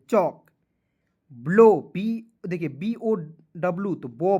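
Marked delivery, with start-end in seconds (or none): 0.1-0.4 चौक with the l silent, "chauk"; correct pronunciation. Shown as a demonstration. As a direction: drop the l and say चौक. (सही उच्चारण: चौक)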